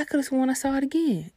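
lightly voiced worried mother; imitating mom. Mom's voice in story.

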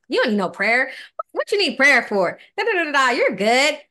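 playfully imitating a dismissive inner voice; mocking dismissal.

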